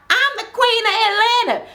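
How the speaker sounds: high-pitched mock grandeur; retold brag, pitched high and falling at the end; haughty boast. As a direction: imitate a diva proclaiming herself. Acted boast in retold quarrel.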